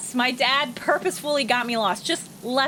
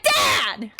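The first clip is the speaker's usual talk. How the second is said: louder, outraged Dad; indignant cry; 3 semitones higher, 5 dB louder than usual. Retold or imitated. Daughter protesting to her dad, indignant loud cry. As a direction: call him out in disbelief. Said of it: "I was like".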